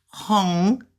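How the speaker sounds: "Hong" gliding upward in pitch; second-tone HONG. Shown as a demonstration. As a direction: lift the end of "Hong" like a question. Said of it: "'cause it's going up".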